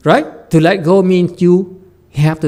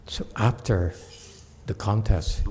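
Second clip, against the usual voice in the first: ordinary talk, nothing marked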